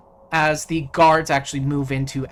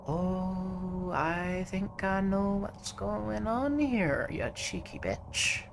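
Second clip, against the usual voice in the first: softer, knowing and sly; 5 semitones higher, 6 dB quieter, slower than usual. Acted. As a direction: purr it out like a smug rogue. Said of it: "he blinks softly and says".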